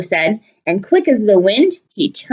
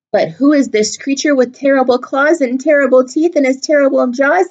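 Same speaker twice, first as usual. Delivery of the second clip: usual speaking voice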